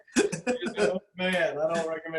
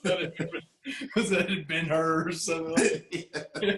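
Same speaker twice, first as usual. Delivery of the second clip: laughing; 3 semitones higher than usual. Retold or imitated.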